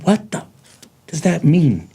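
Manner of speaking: retold exasperated question, pitched high; mad disbelief. Man baffled by God's answer, exasperated. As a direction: break off the curse, then demand the meaning. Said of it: "at first I was mad".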